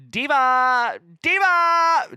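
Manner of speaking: high, loud cry of DIVA; mock scream. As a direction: imitate a screaming drag queen. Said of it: "I think she just screams".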